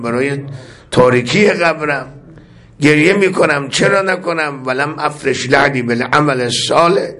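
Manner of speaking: rising plaintive cry of why should I not weep; mournful sermon refrain. Acted. Preacher voicing the Imam's prayer to the mourners, plaintive lament. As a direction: lament each line like a grieving preacher.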